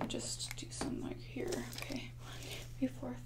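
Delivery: ordinary talk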